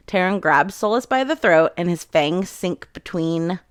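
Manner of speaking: ordinary talk, nothing marked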